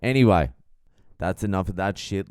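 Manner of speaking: ordinary talk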